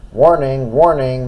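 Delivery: loud ringing warning call; bell voice. Acted. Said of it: "the bell said".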